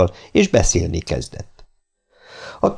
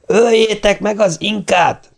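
shouted order; 13 semitones higher, slower than usual. A narrator's reading. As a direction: bark the order in a rage, high and slow. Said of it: "kiáltotta Hernando". (Hernando dühösen, magasan kiáltja a parancsot)

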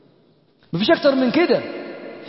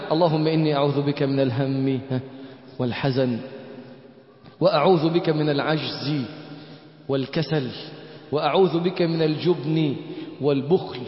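soft, lower prayer voice; quiet earnest prayer, word by word; devout supplication; 8 semitones lower, 6 dB quieter than usual. Acted. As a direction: recite the plea humbly for them to repeat. Leads the morning supplication.

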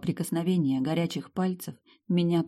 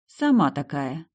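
usual speaking voice

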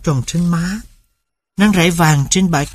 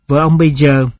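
ordinary delivery

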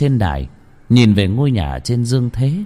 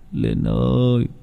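tearful mumbling; 5 semitones higher, slower than usual. A narrator's reading. Grieving father calls his dead daughter home, high slow tearful voice. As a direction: call your lost daughter home through sobs. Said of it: "bật khóc như một đứa bé, miệng mếu máo lẩm bẩm".

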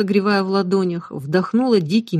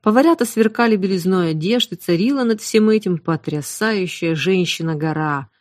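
usual speaking voice

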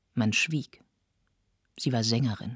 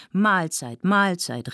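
higher, brisk communal call; 6 semitones higher, 4 dB louder, slower than usual. A narrator's reading. Guests rising from dinner, called out cheerily. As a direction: call it out to the whole table. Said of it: "riefen alle".

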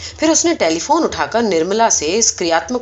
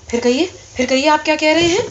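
fast, higher astonished repeat; stunned disbelief; 7 semitones higher, faster than usual. A narrator's reading. Superintendent hears the donkey speak, fast and astonished. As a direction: blurt it out, thunderstruck. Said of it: "آشچریہ وش لگ بھگ مورچھت ہو گیا".

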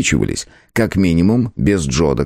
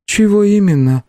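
unemotional; 13 semitones higher, 3 dB louder than usual. A narrator's reading. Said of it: "опять без эмоций спросила".

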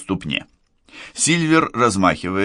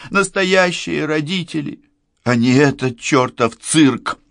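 high-pitched, distraught tirade; anguished, raised-voice protest; tearful outburst; 9 semitones higher, 4 dB louder than usual. A narrator's reading. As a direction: pour it out near tears, voice pitched up. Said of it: "Она плачет".

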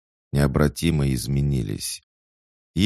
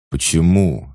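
louder startled why; stunned brother asks why; taken aback; 4 semitones higher, 8 dB louder than usual. Acted. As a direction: blurt it out, caught off guard. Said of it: "опешил Иван".

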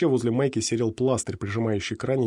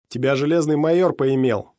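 jeering shout; 4 semitones higher, 6 dB louder than usual. A narrator's reading. Voice from the crowd.

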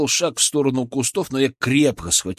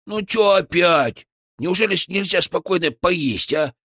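grumpy chipmunk; 6 semitones higher than usual. A narrator's reading. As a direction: complain mid-snack. Chipmunk grumbling.

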